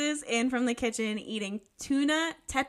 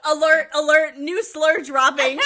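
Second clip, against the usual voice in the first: loud mock breaking alert; mock alarm; 3 semitones higher, 11 dB louder than usual. Co-host announcing a new slur drop, mock alarm. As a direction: blare it like an emergency bulletin.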